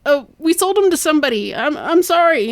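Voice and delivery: fast, high-pitched mock apology; panicked confession. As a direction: blurt out a cornered confession. Cornered son confesses in panic.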